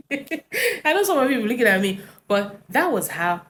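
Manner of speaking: high laughing line. Real, not acted. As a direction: laugh while saying it. Laughs at her own story.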